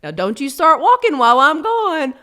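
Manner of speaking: playful warning. Retold or imitated. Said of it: "he literally says to Grace".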